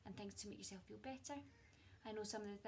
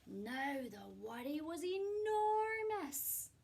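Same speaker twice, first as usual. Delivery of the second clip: slow, loud and higher on enormous; storyteller booming the size of the worry; dramatic emphasis; 7 semitones higher, 11 dB louder, much slower than usual. A narrator's reading. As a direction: make the worry sound huge.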